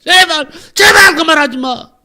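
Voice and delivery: high, loud, drawn-out begging; acted desperate screaming. Possessed young man begs pastor to stop, acted pleading shout. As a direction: plead at a shout as the tormented demon. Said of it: "소리를 막 지르더랍니다".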